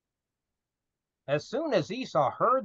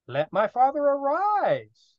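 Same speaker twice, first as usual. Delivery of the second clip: slow higher reading; 8 semitones higher than usual. Shown as a demonstration.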